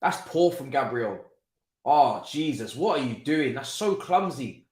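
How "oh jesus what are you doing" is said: ordinary delivery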